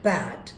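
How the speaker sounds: Bad in one low, falling beat; single-syllable clap word. Shown as a demonstration. Teacher claps out a one-syllable word for students, single falling beat. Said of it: "clap দেই".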